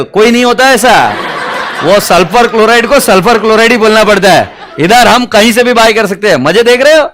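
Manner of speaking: gleeful teasing. Acted. Teasing science students, laughing loudly.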